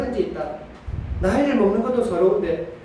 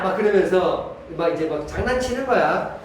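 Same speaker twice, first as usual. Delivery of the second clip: ordinary talk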